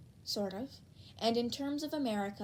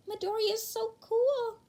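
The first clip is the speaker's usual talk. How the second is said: much higher, louder gushing voice; admiring classmate voice; 12 semitones higher, 5 dB louder than usual. Acted. Classmate gushing over Midoriya in chat, high voice. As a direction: gush like a starstruck fan.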